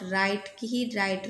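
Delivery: ordinary delivery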